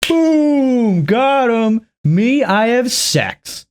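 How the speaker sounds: hammed-up boast, popping up high on Boom; mock smug comedian. Mocking the comedian's joke in a hammed-up brag. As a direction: jeer at a cheap punchline in a lightly put-on brag. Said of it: "Imagine, like, going".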